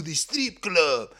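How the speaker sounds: a hint of mock Russian accent; lightly imitated Drago-style Russian accent; Hrgovic's Russian-sounding accent. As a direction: slip briefly into a comic Russian accent. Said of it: "That's how he sounds".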